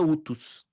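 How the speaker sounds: "utus" starting straight on the OO, no h; unaspirated "utus", stress on the first syllable; no aspiration. Shown as a demonstration. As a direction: say "utus" with a smooth vowel onset, OO-tus. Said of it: "E se não tivesse o tio, seria".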